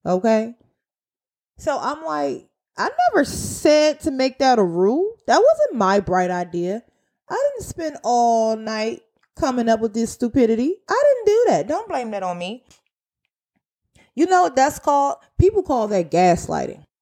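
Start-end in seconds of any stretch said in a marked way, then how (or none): 2.8-12.6 high-pitched, protesting retort; indignant denial. Retold or imitated. Worker refusing blame for the new rule, indignant denial. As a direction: push the denial up high, affronted.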